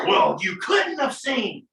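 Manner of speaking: high, slow, hammed-up accusation; cross-examining attorney. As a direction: pounce on the witness with mock outrage. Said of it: "you don't reciprocate their energy".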